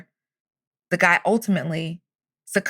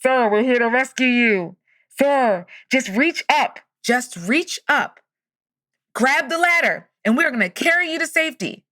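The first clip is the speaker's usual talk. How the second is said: calling down; 5 semitones higher than usual. Retold or imitated. Helicopter crew to stranded man, calling down. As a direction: imitate hollering down from a helicopter. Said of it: "they call down from above".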